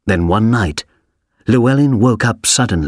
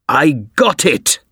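a slowed, higher cry of triumph; triumphant shout; 5 semitones higher than usual. A narrator's reading. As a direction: prince calling out his catch, mildly raised. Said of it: "shouted the prince".

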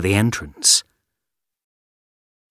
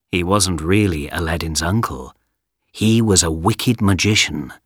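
ordinary delivery, nothing marked

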